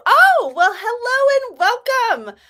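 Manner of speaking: high, loud, stretched greeting; excited welcome. Real, not acted. Streamer welcoming someone who just discovered her, excited greeting. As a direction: sing out a big, high welcome.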